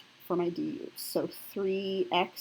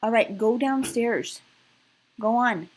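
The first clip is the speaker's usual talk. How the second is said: firm raised voice; 4 semitones higher, 8 dB louder, slower than usual. Real, not acted. Mom insisting again.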